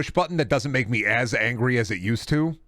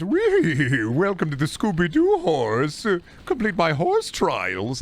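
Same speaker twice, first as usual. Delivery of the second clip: mock the cheery game character; 6 semitones higher, slower than usual. Mocking the game's cheery horse announcer in a high slow singsong. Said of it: "And then it's".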